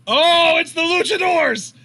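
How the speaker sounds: loud, high-pitched surprised call; excited exclamation. Real, not acted. Commentator spotting the luchadors arriving, loud high excitement. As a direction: shout it as they appear. Wrestlers make a surprise appearance.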